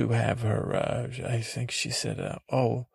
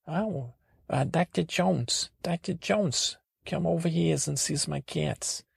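high-pitched mock Florida local; Florida accent; 6 semitones higher than usual. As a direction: call out in an imitation Florida accent. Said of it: "that is the language of Floridas".

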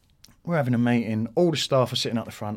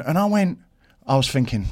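ordinary talk, nothing marked

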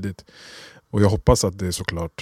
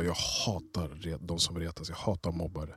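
quiet stern; 3 semitones lower, 13 dB quieter than usual. Retold or imitated. Mother scolding son quietly.

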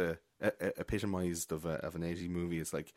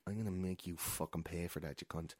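low, much softer menacing promise; quiet threat; 4 semitones lower, 11 dB quieter than usual. Retold or imitated. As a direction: whisper the threat of revenge. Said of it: "looks at Killian in a way like".

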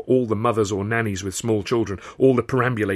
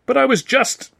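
protest; 6 dB louder than usual. A narrator's reading. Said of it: "I protested".

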